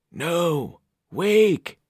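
high ghostly call to wake; overlapping graveyard whispers. Acted. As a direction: spirits of the dead pleading. Ghostly voices urge waking.

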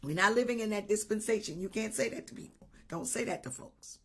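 soft, laughing rebuke; hushed correction. Retold or imitated. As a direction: gently wave the idea away. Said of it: "I said".

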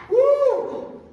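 high, loud "woo"; excited whoop. Retold or imitated. Pastor reliving the revving motorcycles, excited whoop.

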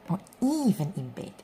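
usual speaking voice

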